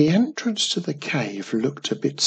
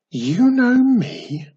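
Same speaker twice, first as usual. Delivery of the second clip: much higher, slow astonished question; surprised; 12 semitones higher, 6 dB louder, slower than usual. Acted. Mum amazed goblin remembers, surprised. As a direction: gasp it out in amazement. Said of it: "exclaimed Mum in surprise".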